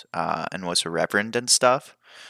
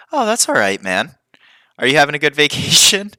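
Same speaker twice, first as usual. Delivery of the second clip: cheery greeting; 9 semitones higher, 7 dB louder, faster than usual. Retold or imitated. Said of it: "And I was like".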